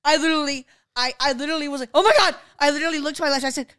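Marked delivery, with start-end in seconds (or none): 1.9-2.4 loud, high oh my God, reliving the shock; shocked gasp. Retold or imitated. Retelling to a friend how she reacted to the quake, loud shocked cry. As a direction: blurt it like the floor just moved.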